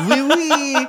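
high, loud mock French; hammed-up French accent, laughing. Co-host showing off on request, in an exaggerated French accent. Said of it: "say this in a French accent".

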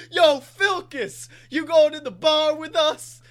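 loud, high, slow bro call; bro shout. Retold or imitated. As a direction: yell it like a pushy buddy. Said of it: "he just goes".

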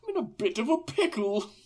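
high mock British gentleman; hammed-up British gent in a squeeze; Tails. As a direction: echo the genteel British distress. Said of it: "He sounds like a distinguished old British gentleman".

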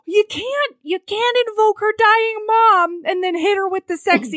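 high-pitched, loud comic indignation; mock outrage. Reader scolding the hero for his seduction line, mock-outraged. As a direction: protest in a squealing, scandalized voice.

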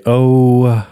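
long held-out thinking oh; imitating a friend's lingering phone oh; imitated drawn-out oh. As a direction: stretch out the oh like someone stalling. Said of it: "is you say".

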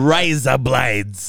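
slow, drawn-out Australian "Razor blades"; Australian accent. Shown as a demonstration. Friend trying out the word trick, broad Australian accent. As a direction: stretch it with Australian "rye-zuh blydes" vowels. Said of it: "razor blades in Australian accent".

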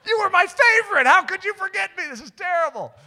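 high, loud, wounded outburst; hammed-up cry of betrayal; indignant wail. Forgotten student to favorite teacher, indignant.

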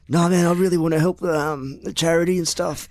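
high, pleading street hustler voice, laughing; sneaky voice. Retold or imitated. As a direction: wheedle it like a shady dealer. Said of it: "that's my sneaky voice".

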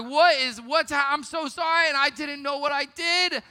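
high, panicked stammering; mock panicked kid saying sorry; flustered apology. Flustered apology to angry mom.

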